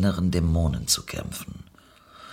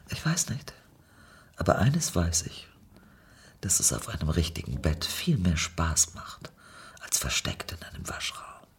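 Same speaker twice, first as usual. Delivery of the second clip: whisper; 4 semitones lower, 8 dB quieter than usual. Acted. Intimate whispered dialogue.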